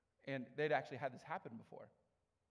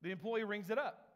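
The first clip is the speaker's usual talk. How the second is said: ordinary delivery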